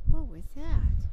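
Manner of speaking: loud, slow, startled question. Real, not acted. Owner startled by a sound, asking out loud. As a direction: react to a sudden noise. Reaction to a sudden noise.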